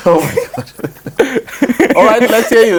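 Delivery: high-pitched, laughing through the words; real laughter. Real, not acted. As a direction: crack up while handing over the floor. Amused reaction to panelist's answer.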